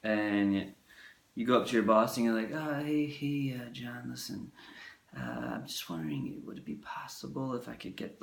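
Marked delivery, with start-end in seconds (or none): 2.5-8.2 very soft hesitant voice, fading away; meek employee begging a favor, barely audible; timid mumble. Retold or imitated. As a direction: shrink and ask the boss nervously. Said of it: "you're talking like this".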